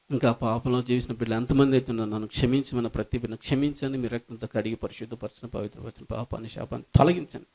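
low, soft praying voice over music; closing prayer. Acted. Pastor praying over the congregation, low and soft. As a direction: pray softly, swelling briefly, then hushed.

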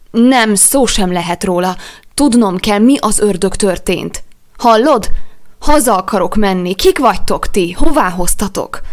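loud, high demanding outburst; angry shouting. A narrator's reading. Jack demanding answers from his rescuers, shouting. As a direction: shout every question at them.

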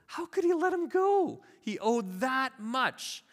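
hammed-up high-pitched outrage and disbelief; acted shock. Crowd questions the master's mercy, acted astonishment. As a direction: protest as a scandalized crowd, voice way up. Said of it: "imagine the gasp from the crowd".

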